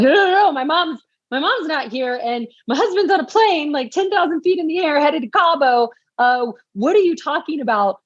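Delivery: high, panicked rush of worry. Retold or imitated. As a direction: spill out the fear, voice up high. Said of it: "I was like".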